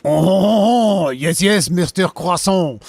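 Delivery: loud, high, hammed-up French accent; mocking French accent. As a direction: crow it like a cartoon Frenchman. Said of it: "Me making fun of".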